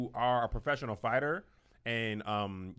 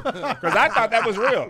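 loud, high, drawn-out laughing voice; slow, high and loud through laughter; laughing admission; 9 semitones higher, 12 dB louder, slower than usual. Real, not acted. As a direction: crack up at your own mistake. Laughing at own mistaken belief.

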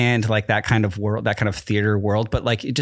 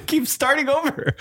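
laughing. Real, not acted. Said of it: "laughing through words".

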